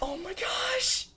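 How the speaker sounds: very high, slow, drawn-out mock awe; stunned amazement. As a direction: stretch it out in disbelief. Retold amazement at two Staryus.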